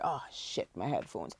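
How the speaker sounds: hushed, nearly voiceless aside; low, very soft retold realisation; retold mutter to self. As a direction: say it under your breath, as if to yourself. Retold muttered thought to herself.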